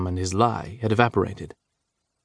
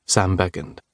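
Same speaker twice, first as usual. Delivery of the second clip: usual speaking voice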